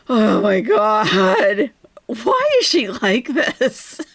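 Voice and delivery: loud, slow, drawn-out disbelief through laughter; laughing exasperation. Real, not acted. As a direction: wail it slowly while laughing.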